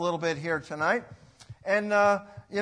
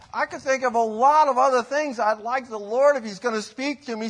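usual speaking voice

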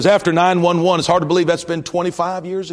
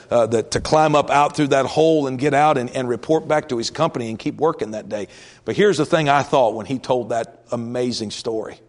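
ordinary talk

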